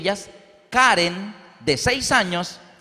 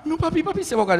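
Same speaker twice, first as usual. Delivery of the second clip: put-on high, frightened little girl; four-year-old daughter panicking at the movie; scared child crying out; 11 semitones higher, much faster than usual. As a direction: plead with papi that the boy will drown. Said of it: "enterraba la cabeza".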